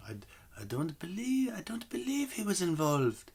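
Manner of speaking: a hint of a French mother; gentle French-tinged mother; half French accent. Shown as a demonstration. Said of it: "That's not bad, actually".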